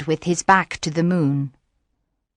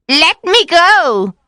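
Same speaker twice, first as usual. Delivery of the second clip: high, loud, drawn-out plea; witch's cry; 11 semitones higher, 5 dB louder, slower than usual. A narrator's reading. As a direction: cry out to be released, pitch right up. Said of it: "she cried".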